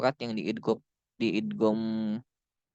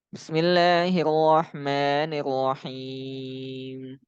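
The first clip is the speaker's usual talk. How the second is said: slow recitation with long held vowels; Quran recitation; much slower than usual. Shown as a demonstration. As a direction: recite it drawn out, last syllable held very long. Recites example for reading long vowels. (membaca Al-Qur'an pelan dengan mad panjang)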